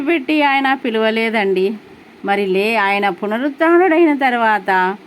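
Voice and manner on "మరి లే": usual speaking voice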